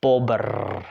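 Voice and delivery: bobr with the final trill held; very long R. Shown as a demonstration. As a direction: pull the R at the end of bobr as long as possible. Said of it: "how long can you pull that R?".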